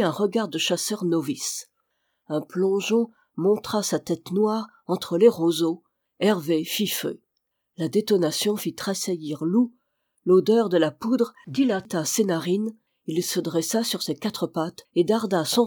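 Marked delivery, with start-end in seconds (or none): none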